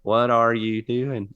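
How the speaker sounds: badly faked Irish robot accent. Shown as a demonstration. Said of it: "Do the Irish robot".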